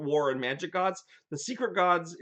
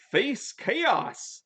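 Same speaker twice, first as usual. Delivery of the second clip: mock spell shout; 9 semitones higher than usual. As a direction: cast the spell in a high, hammed-up call. Greg casting spell in retelling.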